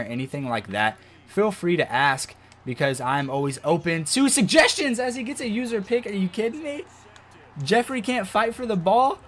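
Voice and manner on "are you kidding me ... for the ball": higher frustrated disbelief; raging gamer. Real, not acted. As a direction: complain at the screen. Receiver fails to catch.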